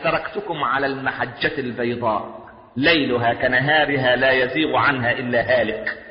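low, slow measured quotation; solemn recitation. Acted. Preacher quoting the Prophet's saying, low and slow.

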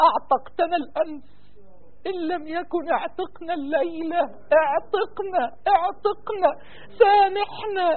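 soft, imploring prayer climbing in pitch; tearful begging. Real, not acted. Imam begging God for release tonight, tearful pleading. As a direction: beg softly for mercy, voice rising.

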